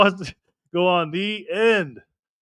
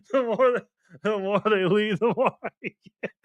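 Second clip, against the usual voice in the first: cracking up mid-sentence; can't get the words out for laughing; 4 semitones higher than usual. Real, not acted. Streamer reading a viewer's joke to chat, laughing. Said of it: "I can't even say this without laughing".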